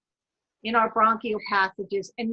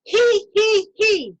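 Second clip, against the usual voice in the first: much higher, loud he, he from the throat; 9 semitones higher, 8 dB louder, much slower than usual. Shown as a demonstration. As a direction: giggle it out from the throat. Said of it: "breathe in".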